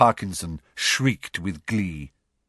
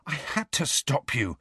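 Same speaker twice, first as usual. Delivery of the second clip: high, breath-starved, softer words; gasping; 5 semitones higher, 5 dB quieter than usual. A narrator's reading. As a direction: gasp it out after a struggle. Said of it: "Harry gasped".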